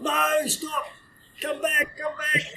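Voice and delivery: high yelled calls to a runaway dog; retold yelling. Handler yelling after his dog to come back. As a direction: call the dog back at the top of your voice. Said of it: "The handler's behind going".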